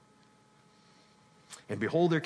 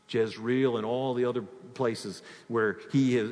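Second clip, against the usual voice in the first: usual speaking voice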